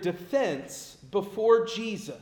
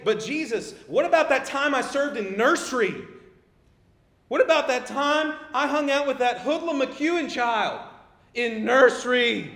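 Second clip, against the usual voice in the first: pleading churchgoer; 5 semitones higher, 5 dB louder than usual. Pretend.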